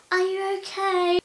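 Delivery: put-on high, slow, sing-song voice for a small child; exaggerated baby-talk concern; patronising stranger. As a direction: mimic a stranger talking down to her as if she were five. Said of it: "talk to me like I'm five".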